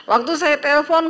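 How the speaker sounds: normal talking voice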